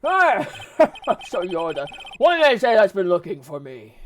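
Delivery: put-on loud, booming old lawyer role; pompous Grossberg. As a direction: burst in grandly on a high exclamation.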